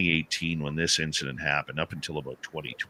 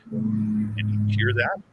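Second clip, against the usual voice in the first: slow, drawn-out question, pitched up; astonished question; 4 semitones higher, much slower than usual. Retold or imitated. Dave asks Merle about the chatter, slow astonished question. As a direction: stretch the words out, rising on THAT. Asking companion about strange noise.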